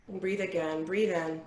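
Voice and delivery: calm, unhurried guiding voice with long pauses; guided meditation. Acted. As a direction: lead the group gently through their breathing. Leads a breathing meditation.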